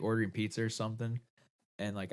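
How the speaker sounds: ordinary talk, nothing marked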